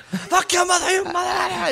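very high screeched insult; wildly hammed-up fury; mock raging scream. Friend imitates passenger going off on toll guy, screeched rage.

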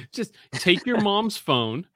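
ordinary delivery

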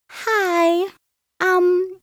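high, polite mock phone greeting; imitating mama code-switching for a caller.

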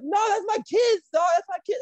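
high-pitched imitation of a pleading refusal; mock protest. Jazz refusing to hit his supposed kid, high mock protest.

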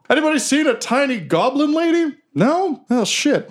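high, put-on character voice calling out; searcher in the crowd. Guard asking the crowd after a goblin, high searching voice. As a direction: ask around in a high, squeaked-up voice.

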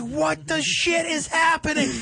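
high, drawn-out retold freak-out; flipping out. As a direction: stretch it out high, flipping out in disbelief. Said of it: "I was fucking flipping out".

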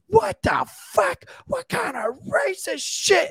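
outraged bystander. Retold or imitated. Bystander woman accusing the officer, high outraged shout. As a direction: shriek it in outrage at the cop. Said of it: "This woman behind me goes".

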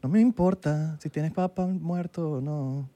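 slow, drawn-out cheesy come-on; mock seductive voice. Friends parodying flirty texts, slow sleazy mock come-on.

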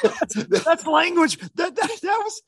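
high, laughing through the words. Real, not acted. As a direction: crack up while saying it. Laughing at own story.

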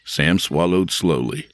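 plain reading voice

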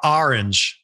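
"orange" said as "AR-inj", Philadelphia style; imitating a Philly friend's "orange" as "AR-inj"; Philly pronunciation. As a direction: open the first vowel of "orange" to "ar". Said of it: "a friend from Philly who says".

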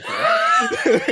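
laughing loudly through the words. Real, not acted. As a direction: crack up while saying it. Laughing at Joker entrance.

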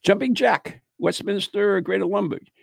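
ordinary talk

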